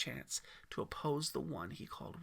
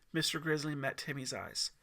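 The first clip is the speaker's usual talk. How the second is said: ordinary delivery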